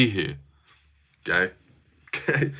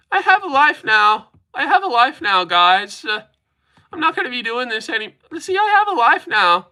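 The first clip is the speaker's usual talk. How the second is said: high-pitched mocking impression; Nubby; 8 semitones higher than usual. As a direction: pitch it high and plead with the viewers. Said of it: "do a Nubby impression".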